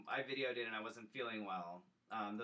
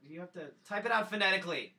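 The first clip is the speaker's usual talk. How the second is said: ordinary delivery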